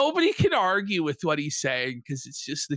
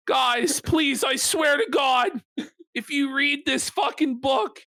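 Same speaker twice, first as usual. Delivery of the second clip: mock pleading; 7 semitones higher than usual. Streamer voicing Joe begging the audience to read the book, pleading. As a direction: plead in a hammed-up high voice.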